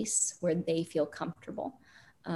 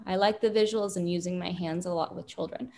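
ordinary talk

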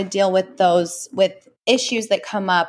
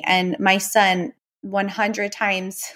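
ordinary talk, nothing marked

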